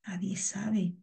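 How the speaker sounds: hushed, unvoiced "Nadie sabe"; a conspiratorial aside to the class; secretive whisper. Explaining a secret, clandestine romance.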